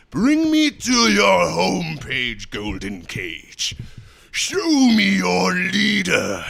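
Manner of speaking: loud, very slow, drawn-out demand; hammed-up command, stretching every word; put-on character voice.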